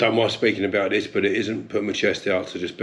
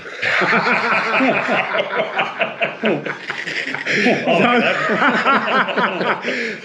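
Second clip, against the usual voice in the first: hearty laughter; 12 semitones higher, 5 dB louder than usual. Real, not acted. Laughing at his failed Geordie accent.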